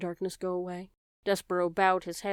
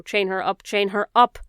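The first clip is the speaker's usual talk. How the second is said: louder on the repeat, a furious command; the rat yells at the others, louder each time; shouted order; 10 dB louder than usual. Acted. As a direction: chain her up, building to a yell.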